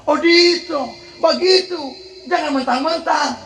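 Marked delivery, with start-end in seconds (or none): none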